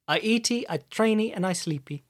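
lightly voiced Brazilian fighter, playful; a touch of a Brazilian accent; Brazilian fighter impression. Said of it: "weird voiced Brazilians".

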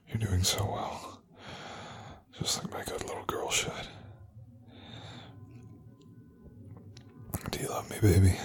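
cooing over a crying lover, softly; tender. Acted. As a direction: hush her gently after the tears. Soothing partner's tears.

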